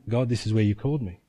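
low, subdued prayer to God; retold prayer, softly and low; recalled prayer. Chaplain reminding himself before God, low subdued prayer. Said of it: "it kept coming back".

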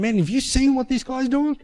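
high-pitched admiring enthusiasm; retold glowing praise. Paul praising his colleague to the president, high enthusiastic praise. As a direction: talk him up, voice pitched well up. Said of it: "sing this man's praises".

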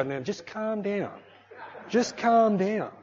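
a wife slowly calming her fiery husband; soothing wife. Retold or imitated. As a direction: gently talk him down, drawing out calm. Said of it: "she would go".

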